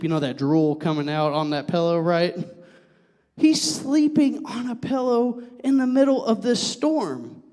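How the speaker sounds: normal talking voice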